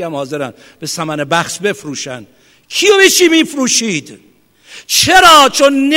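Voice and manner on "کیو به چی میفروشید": ordinary delivery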